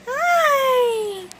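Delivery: high squeaky voice for the hamster; squeaky pet voice. Pretend. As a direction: speak for the hamster, high and tiny. Voicing the hamster's greeting.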